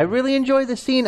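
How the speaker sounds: said again up high. Shown as a demonstration.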